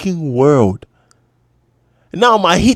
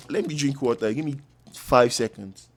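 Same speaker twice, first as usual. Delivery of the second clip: ordinary delivery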